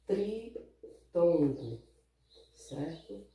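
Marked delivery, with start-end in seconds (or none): 0.1-1.8 tritongo stretched out very slowly; slow, careful teacher's tritongo; slow emphasis. Shown as a demonstration. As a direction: draw out tritongo for the class to hear. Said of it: "ela é chamada de".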